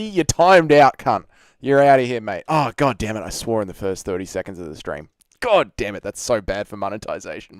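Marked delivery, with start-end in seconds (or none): none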